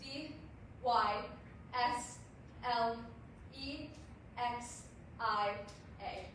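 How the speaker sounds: each letter called out separately; spelled out. Acted. Poet spelling dyslexia to the audience, one letter at a time.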